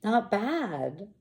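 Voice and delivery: normal talking voice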